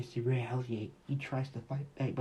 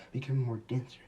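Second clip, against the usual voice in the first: plain reading voice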